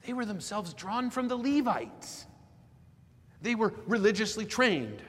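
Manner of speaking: emphatic pulpit reading, climbing high at the end; declaimed sermon quotation. Real, not acted. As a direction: read it out like a preacher making his case. Preacher declaims a commentator's quote.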